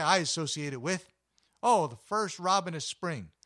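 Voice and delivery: excited. Retold or imitated. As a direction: gush like a fan of spring. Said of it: "And people say".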